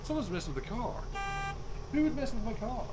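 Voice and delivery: high, loud indignant cry; hammed-up alarm over the car; mock outrage. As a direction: exclaim in exaggerated dismay. Finds car tampered with.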